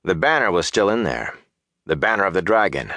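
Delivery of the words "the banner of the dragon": plain reading voice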